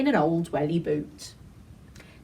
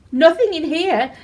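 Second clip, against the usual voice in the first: put-on high and loud child voice; loud high girl's call; little girl; 8 semitones higher, 8 dB louder than usual. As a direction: announce it brightly as a child. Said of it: "the little girl said".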